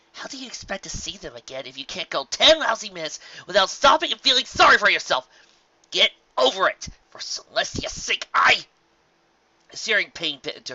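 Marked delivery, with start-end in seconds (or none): 0.1-8.7 high, loud, exasperated telling-off; angry self-scolding. Acted. Rainbow Dash berating herself mid-flight, high and loud. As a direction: snap at yourself to quit moping.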